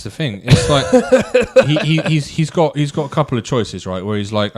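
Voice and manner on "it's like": excited outburst. Real, not acted. Excited argument.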